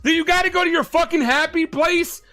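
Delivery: usual speaking voice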